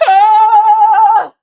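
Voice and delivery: loud, high opening wail; big diva scream into the song. Pretend. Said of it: "That was my interpretation of, uh, [name]".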